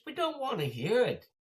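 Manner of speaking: very high, loud mock shout; exasperated, high-pitched heckling; fed-up heckler yell. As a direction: shout the preacher down in imitation. Imitating fed-up heckler yelling.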